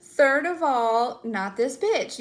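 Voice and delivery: emphatic sassy finale; sassy dismissal. Pretend. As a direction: spell out each word with attitude. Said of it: "this energy of like".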